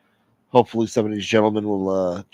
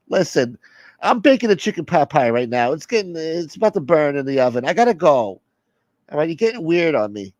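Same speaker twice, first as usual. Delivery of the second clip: raised pitch, hurried to get away; exasperated soother, making excuses; 6 semitones higher than usual. Acted. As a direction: fend off the clingy caller in a higher voice.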